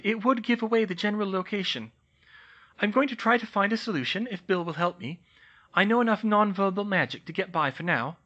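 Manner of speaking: put-on young witch calmly explaining her note; composed young witch. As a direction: lay out the plan briskly. Hermione's note read aloud.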